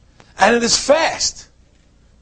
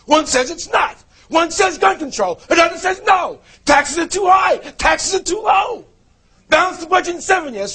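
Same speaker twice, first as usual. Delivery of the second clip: rising rant; 5 semitones higher than usual. Acted. Mocking back-and-forth politics.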